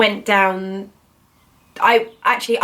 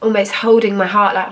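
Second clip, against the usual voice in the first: ordinary talk, nothing marked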